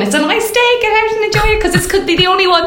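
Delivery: high, sing-song Irish mammy voice; mother's voice. Retold or imitated. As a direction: urge it like a cheery mammy. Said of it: "that little voice in our head going".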